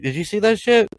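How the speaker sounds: high, excited squeal at a ghost; mock excited shout. Friend pointing out Lincoln's ghost, high excited squeal. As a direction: play it up like you just saw a ghost. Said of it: "I'm like".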